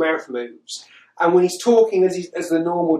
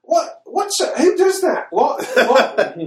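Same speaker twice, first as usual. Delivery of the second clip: high, exasperated disbelief; baffled, high-pitched outburst; incredulous; 11 semitones higher, 4 dB louder, slower than usual. Real, not acted. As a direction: splutter the questions in disbelief. Incredulous at the aliens' plan.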